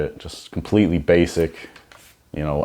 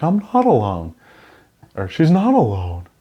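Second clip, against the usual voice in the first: mock movie line; 6 semitones higher than usual. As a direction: deliver it like a stiff movie hero. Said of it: "you wouldn't just be like".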